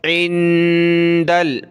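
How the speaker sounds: इंदल very slow, n hummed through the nose; nasal noon. Shown as a demonstration. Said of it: "नाक में आवाज़ पैदा करेंगे".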